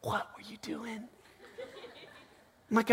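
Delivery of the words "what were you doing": very soft, higher mock reproach; barely voiced, incredulous question; wife's quiet disbelief. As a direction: imitate a wife's hushed questioning. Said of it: "she's like".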